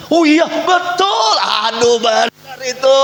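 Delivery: high, slow, exaggerated gossiping voice; hammed-up eager agreement with the gossip; mock gullible agreement. Preacher mimics a congregant agreeing with gossip, squeaky mock voice. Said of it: "Apakah saudara bilang".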